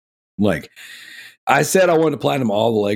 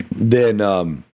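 coughing fit; 3 semitones lower, slower than usual. Real, not acted. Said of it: "I can't stop coughing".

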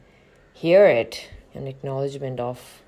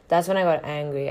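ordinary talk

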